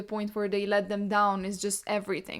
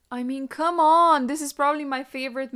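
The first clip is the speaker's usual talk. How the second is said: excited outburst; 5 semitones higher, 5 dB louder than usual. Real, not acted. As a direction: burst out at a favourite moment. Gushing over favorite book moment.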